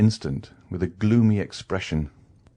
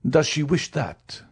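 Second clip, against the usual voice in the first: slow, much higher, pointed question; startling accent; 7 semitones higher than usual. A narrator's reading. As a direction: let the question land sharp and startling. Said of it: "with a startling accent".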